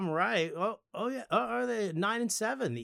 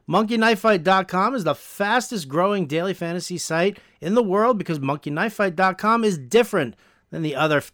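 upbeat podcast ad read, a bit louder; sponsor ad read; 6 dB louder than usual. Acted. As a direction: sell it like a host-read ad. Reads sponsor ad.

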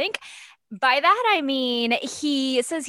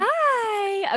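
puppy talk; 12 semitones higher than usual. Real, not acted. Greeting the puppy.

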